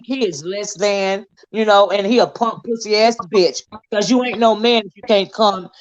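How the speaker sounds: ordinary talk, nothing marked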